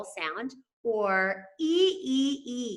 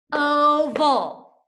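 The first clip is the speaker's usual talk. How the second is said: Oval higher and louder, two clear beats; syllables set apart; 6 semitones higher, 7 dB louder than usual. Shown as a demonstration. Teacher sounding out Oval for the class. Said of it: "has its own syllable".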